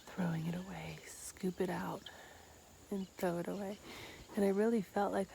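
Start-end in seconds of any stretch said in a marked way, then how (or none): 0.1-3.8 whispering. Real, not acted. Describing scooping out chakra.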